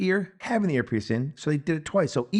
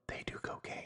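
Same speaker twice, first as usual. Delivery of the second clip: mock-secret hushed whisper; conspiratorial whisper; 19 dB quieter, faster than usual. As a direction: whisper it as if tipping off the viewer. Filmmakers letting viewer in on secret.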